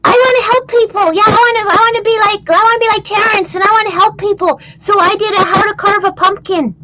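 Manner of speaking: high, playful puppet voice; chirpy little puppet character; What What puppet voice. Acted. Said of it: "This is my little friend".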